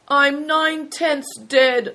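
high, slow, stubborn complaint; obstinate. A narrator's reading. Hailstone contradicting the prince, obstinately self-pitying. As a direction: insist on it in a drawn-out high voice. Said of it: "asserted Hailstone obstinately".

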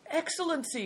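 high, pleading call; high appeal to a superior; crying out. A narrator's reading. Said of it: "cried the man".

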